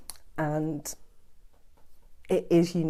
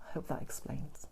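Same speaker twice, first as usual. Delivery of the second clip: soft whisper; 3 semitones lower, 10 dB quieter than usual. Real, not acted. Sign-off to viewers, voice drops.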